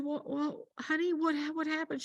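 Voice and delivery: gentle question. Retold or imitated. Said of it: "And I said".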